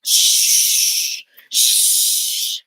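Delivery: loud breathy hiss; dragon hiss. Acted. Dragon answers the little girl with a hiss.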